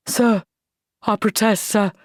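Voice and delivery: a touch of held-back anger; mild anger. Acted. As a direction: protest stiffly to the officer. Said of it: "quivering with anger".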